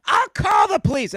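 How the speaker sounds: hammed-up shrill old lady yell; mock old woman threat. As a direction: ape her shouting that she'll call the cops. Quoting the angry woman.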